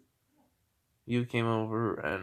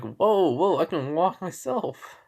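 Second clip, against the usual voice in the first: higher-pitched startled objection; acted protest; 5 semitones higher, 4 dB louder than usual. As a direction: protest at being dragged along, pitched up. Protesting while being dragged inside.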